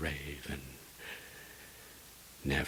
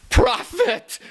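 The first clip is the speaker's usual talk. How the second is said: very high loud shriek; 18 dB louder than usual. Acted. Lover shrieking at the raven. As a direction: scream the name.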